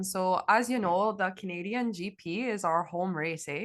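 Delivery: hammed-up Canadian "eh" accent; mock Canadian accent. Canadian co-host jokes about home race, exaggerated Canadian accent. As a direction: play up the Canadian cliché, eh and all. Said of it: "I can't do it".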